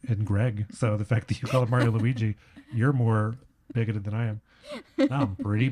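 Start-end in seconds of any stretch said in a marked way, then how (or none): none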